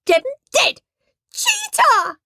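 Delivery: high shrill accusing howl; girl howling cheater at Henry. A narrator's reading. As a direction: wail it like a furious child. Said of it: "howled Moody Margaret".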